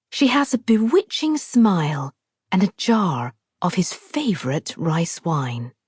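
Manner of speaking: plain reading voice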